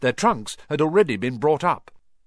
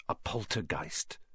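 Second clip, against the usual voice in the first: hushed, much softer naming of the ghost; whispered; 8 semitones lower, 12 dB quieter than usual. A narrator's reading. Percy warning the first years, hushed and soft. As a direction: lean in and breathe the name low. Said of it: "Percy whispered to the first years".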